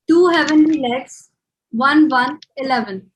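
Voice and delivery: bingo caller. Acted. Housie number call with rhyme.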